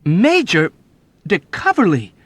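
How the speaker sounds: high, loud startled cry; young officer crying out a name; shocked cry. A narrator's reading. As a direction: blurt the name out in alarm. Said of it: "he cried".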